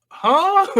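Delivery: high, loud questioning yelp; exaggerated "huh?" shooting up high; mock bafflement. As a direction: play a stunned onlooker. Retold gang baffled by his flying.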